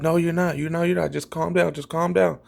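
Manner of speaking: imitated soothing girlfriend. Girlfriend calming him as he marches, imitated soothing.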